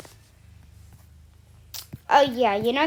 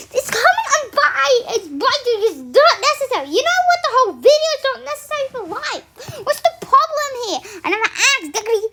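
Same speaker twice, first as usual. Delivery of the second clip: high, loud, fast, laughing rant; exasperated outburst; 10 semitones higher, 5 dB louder, faster than usual. Real, not acted. Reading absurd comments, a high, loud, laughing rant. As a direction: blow up at the comment section in disbelief.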